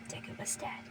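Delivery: a hushed, unvoiced whisper. A narrator's reading. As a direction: let it fall to a breath. Quiet realization of death.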